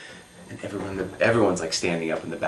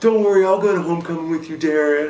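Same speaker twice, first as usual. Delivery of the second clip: high, loud holler; yelled taunt; 10 semitones higher, 8 dB louder than usual. Retold or imitated. Linebacker teases rejected boy across the quad, yelling. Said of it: "yells out across the quad".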